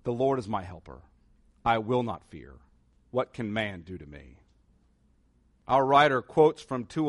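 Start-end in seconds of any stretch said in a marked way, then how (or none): 0.0-4.4 lower, softer, a hushed but firm confession; boldly said. Shown as a demonstration. Pastor urging the congregation to say it boldly, hushed. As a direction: say it boldly under the breath. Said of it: "boldly say".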